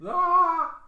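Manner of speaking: high startled yelp; mock startled yelp. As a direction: jump at the scare. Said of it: "Did I scare you?".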